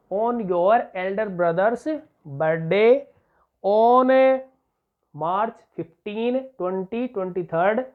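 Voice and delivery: slow, word by word for copying; slow dictation. Shown as a demonstration. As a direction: spell out the model sentence slowly for the class. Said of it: "मैं बना के दिखा रहा हूँ आपको". (धीरे-धीरे रुक-रुक कर लिखवाना)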